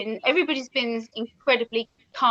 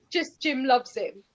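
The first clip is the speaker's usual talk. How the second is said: ordinary talk, nothing marked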